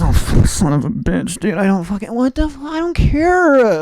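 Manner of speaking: imitated exasperation, climbing to a high stretched end; grumble, then build into a mock wail; frustrated. Imitating later-career comic's rant, building frustration. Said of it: "he got more frustrated".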